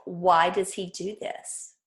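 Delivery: ordinary talk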